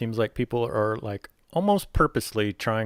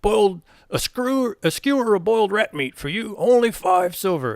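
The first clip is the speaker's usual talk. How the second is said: much higher, louder goblin sales pitch; goblin listing wares; 11 semitones higher, 7 dB louder than usual. Acted. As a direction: reel off the goods in a squeaky goblin voice. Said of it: "keeps listing off things".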